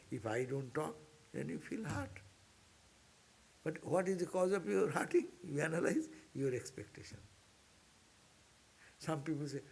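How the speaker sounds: usual speaking voice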